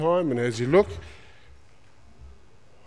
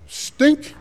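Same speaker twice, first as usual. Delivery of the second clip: offended snort; 12 semitones higher, 4 dB louder than usual. Acted. Proud captain offended.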